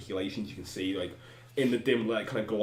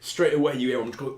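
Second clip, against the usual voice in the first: ordinary talk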